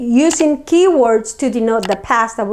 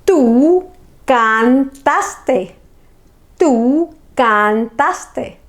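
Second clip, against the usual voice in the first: very slow, syllable by syllable "Tú cantaste"; slow model sentence; much slower than usual. Shown as a demonstration. Teacher modelling a verb form for students, very slow. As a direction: drag it out so students can copy.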